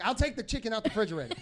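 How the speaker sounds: put-on quick laughing New York accent, a little bit; New York accent slip. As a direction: let the New York accent creep in lightly. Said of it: "I was like".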